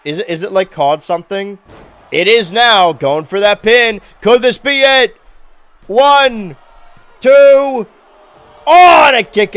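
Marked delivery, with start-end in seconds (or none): 2.1-9.6 loud, high play-by-play call; wrestling commentator. Acted. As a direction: call the pin count like a ringside announcer. Live play-by-play of the match.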